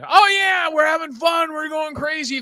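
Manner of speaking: high, loud whooping; hammed-up party excitement; mock party cheer. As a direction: cheer like carefree youth. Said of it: "we thought".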